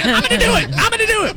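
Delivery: very high mock threat, yelled over and over; hammed-up shrieky line-cutter tantrum; pretend yelling. Host keeps up crazy line-cutter act, high mock yell. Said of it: "but I keep saying".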